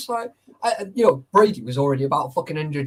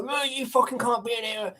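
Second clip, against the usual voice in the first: mock guard; 8 semitones higher, 3 dB quieter than usual. Retelling the guard's challenge.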